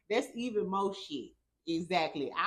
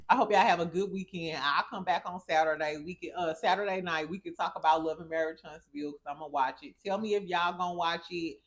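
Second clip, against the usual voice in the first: ordinary delivery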